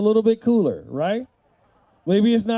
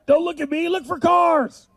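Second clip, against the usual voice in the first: bossy dad; 6 semitones higher than usual. Pretend. Dad bossing kids at theater.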